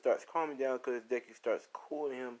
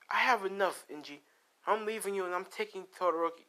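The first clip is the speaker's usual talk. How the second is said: fed-up wife; 9 semitones higher than usual. Retold or imitated. Acted quarrel, wife walking out.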